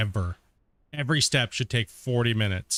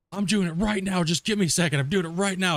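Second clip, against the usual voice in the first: higher-pitched scammer voice, urgent; mock scammer insisting; 7 semitones higher than usual. Streamer imitates the scammer claiming he's hacking, mock urgency. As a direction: rush him like a flustered scammer. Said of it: "He was just screaming at me".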